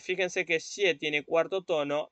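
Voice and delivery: ordinary delivery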